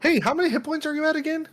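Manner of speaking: high, loud, fast innocent question; sly GM voice. Retold or imitated. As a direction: fake innocent checking in. GM asking player sly question.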